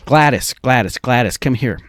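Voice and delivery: loud call across the street. Retold or imitated. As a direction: summon her from afar, loudly. Calling to a neighbour across.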